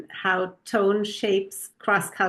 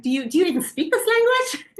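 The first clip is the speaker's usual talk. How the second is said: retold exasperation; 10 semitones higher than usual. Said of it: "And I'm like".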